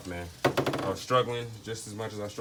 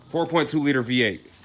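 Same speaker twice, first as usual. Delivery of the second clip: corrected, said louder and higher; correcting himself louder; 6 semitones higher, 9 dB louder than usual. Real, not acted. Mechanic fixing his slip to viewers, louder and higher. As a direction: punch "four point two liter V eight" up as a fix. Corrects the engine size loudly.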